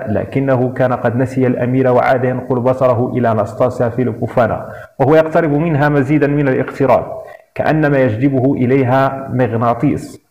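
plain reading voice

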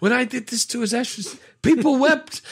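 high, solemn mock grandeur; hammed-up bellhop line. Repeating the bellhop's funeral boast, high and solemn. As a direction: deliver it like a pompous eulogy.

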